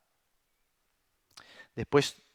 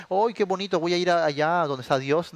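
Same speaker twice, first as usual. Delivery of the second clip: mock delight; 6 semitones higher, 6 dB louder than usual. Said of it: "tú crees que dice".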